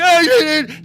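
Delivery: high, loud mock wailing; imitated crying. Boy pleading with his mom behind the door, mock crying. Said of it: "like, he's crying".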